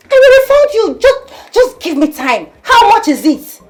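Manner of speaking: high, loud, exasperated outburst; furious shouting. Acted. Woman promising her man a refund, shouting in exasperation. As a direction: yell back, pitch way up.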